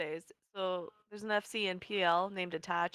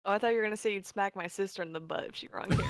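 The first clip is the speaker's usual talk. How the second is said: usual speaking voice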